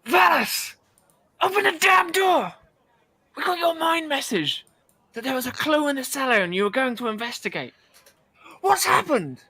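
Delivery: high, loud shout through a door; muffled shout. Acted. Friend shouting through the cell door at Valis. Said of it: "you hear, like, a muffled shout".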